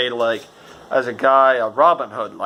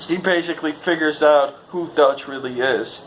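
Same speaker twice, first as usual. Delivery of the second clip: usual speaking voice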